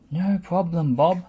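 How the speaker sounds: loud, high cheerful reply; chugging. A narrator's reading. Scoop chugging a loud reply to Bob. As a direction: chug it out like an engine. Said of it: "chugged Scoop".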